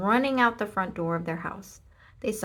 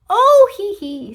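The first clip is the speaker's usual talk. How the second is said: high, loud, slow giggle; 12 semitones higher, 16 dB louder, slower than usual. A narrator's reading. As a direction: squirm and laugh under the pig. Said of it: "said the little man".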